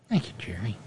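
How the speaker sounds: whispered thank you; breathy hushed thanks. Real, not acted.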